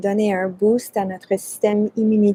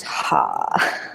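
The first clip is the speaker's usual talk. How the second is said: breathy laugh; 5 dB quieter than usual. Real, not acted. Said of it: "breathing out stress".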